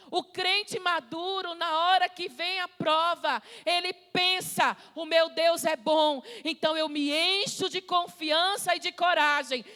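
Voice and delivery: forceful, building sermon voice; impassioned preaching. Real, not acted. Preacher urging the church to trust the Father, impassioned preaching.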